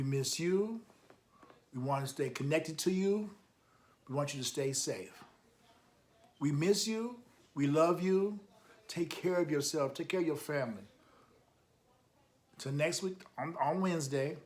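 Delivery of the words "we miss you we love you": plain reading voice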